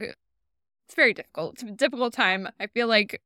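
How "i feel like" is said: normal talking voice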